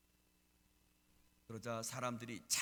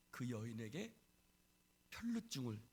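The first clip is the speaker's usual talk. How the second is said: ordinary talk, nothing marked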